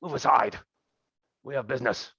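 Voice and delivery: commanding NPC bark; barked order. Acted. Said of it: "barks out".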